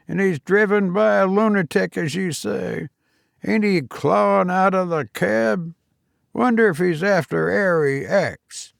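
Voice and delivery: a cockney cabman watching the chase; old cabman Toodles. Acted. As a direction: gossip like an old London loafer.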